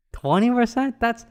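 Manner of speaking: "Twenty percent?" thrown up high in disbelief; disbelieving. Real, not acted. Telling viewers her shocking score, incredulous.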